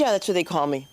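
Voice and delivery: usual speaking voice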